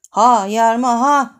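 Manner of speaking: ordinary delivery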